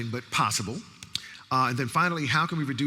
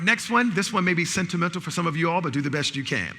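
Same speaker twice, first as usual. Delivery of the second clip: normal talking voice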